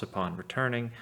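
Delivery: ordinary delivery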